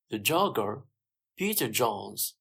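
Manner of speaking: usual speaking voice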